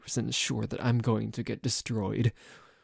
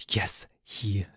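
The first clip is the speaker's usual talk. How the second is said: whisper; 3 semitones lower, 4 dB quieter than usual. Acted. Hushed aside about cat.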